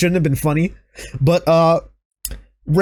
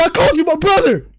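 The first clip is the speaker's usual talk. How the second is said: hurt brother plea; 9 semitones higher than usual. Retold or imitated. Reenacting Jay's plea.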